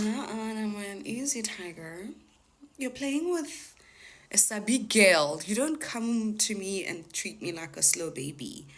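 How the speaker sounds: put-on street-smart girl putting a scammer in his place; sassy retort. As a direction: tell him off with attitude.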